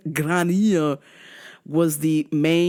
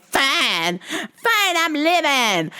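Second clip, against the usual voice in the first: higher, whiny mock snowflake voice; mocking activist; 7 semitones higher than usual. The comic mocking the sister storming out, whiny. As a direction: sneer at the sister as a drama queen. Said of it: "She's like".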